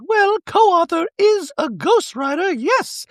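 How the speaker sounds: very high, slow, affected mock Dorit; high slow mocking Dorit bragging about co-authors. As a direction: play her as a haughty caricature. Dorit voiced in recap.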